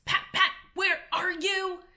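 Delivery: high, strained call for the servant; angry call. Acted. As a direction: yell for the missing gardener. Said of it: "the rabbit's angry voice".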